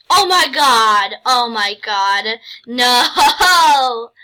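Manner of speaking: drawn-out, shouted oh my God; can't believe the gift, yelling it out; loud disbelief. Real, not acted. Gamer yelling at a donor's gift in loud disbelief.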